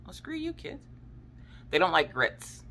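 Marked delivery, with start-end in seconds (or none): 0.0-0.8 mock offended aside. Joking reply to kids refusing.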